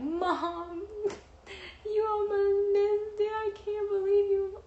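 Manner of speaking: high, loud, drawn-out wail; mock bawling. Daughter in labour to late mom, hammed-up high wailing.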